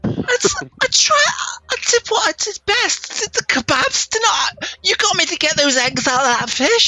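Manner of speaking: very high, squeaky self-defence; Goyle. Acted. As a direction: plead your case in a tiny, piercing voice. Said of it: "Goyle says".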